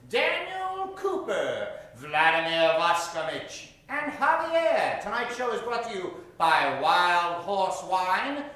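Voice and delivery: normal talking voice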